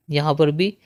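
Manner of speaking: ordinary talk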